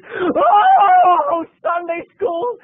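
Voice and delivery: high, loud shriek; screaming mom. Retold or imitated. Mom finds her house changed and screams. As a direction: scream it from the soul. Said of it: "she screamed".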